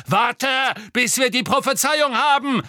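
high, loud, angry command; snapped order. A narrator's reading. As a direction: snap harshly to rein in an ally. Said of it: "schnauzte Malfoy".

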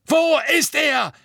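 full, high-pitched furious shout; roar. A narrator's reading. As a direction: bellow it at full force. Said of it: "brüllte Fudge".

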